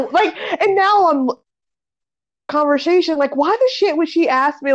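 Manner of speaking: ordinary talk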